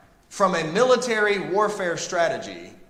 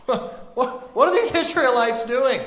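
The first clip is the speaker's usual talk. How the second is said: mocking laugh; 9 semitones higher, 5 dB louder than usual. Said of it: "the people of Jericho looking down".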